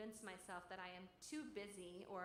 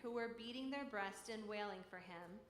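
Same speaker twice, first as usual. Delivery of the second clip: louder, declaiming gospel reading; solemn public reading, voice raised; proclaimed scripture reading; 2 semitones higher, 4 dB louder than usual. Acted. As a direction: proclaim the passage to a listening congregation. Louder gospel reading of Jesus' words.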